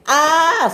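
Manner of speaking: loud high "ah!"; high acted yell; scream. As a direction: scream just before the crash. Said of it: "screamed Ben".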